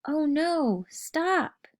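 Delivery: a kid's mock panic, high; scared yell. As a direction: yelp it as the cart hits. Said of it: "I yelled out".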